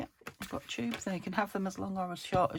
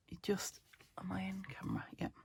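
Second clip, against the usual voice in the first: whispering; 8 dB quieter than usual. Real, not acted. Aside to someone off camera.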